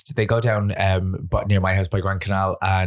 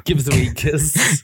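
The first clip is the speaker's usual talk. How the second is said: mock flirty; 5 semitones higher than usual. Playful kiss-begging bit.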